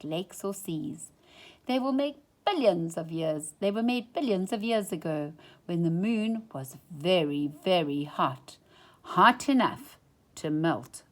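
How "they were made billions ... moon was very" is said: ordinary delivery, nothing marked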